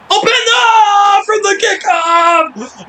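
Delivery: huge drawn-out high goal scream; stretched, ecstatic goal call; goal shout. Real, not acted. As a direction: roar it like a commentator losing it. Goal scored, commentator erupts.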